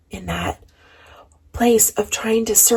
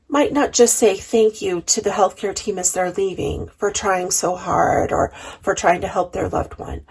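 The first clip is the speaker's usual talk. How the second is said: normal talking voice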